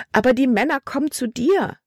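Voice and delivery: high, worried daughter's voice; alarmed protest. A narrator's reading. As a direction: plead urgently with your father. Libby protests to her father. (besorgt, hoch und drängend)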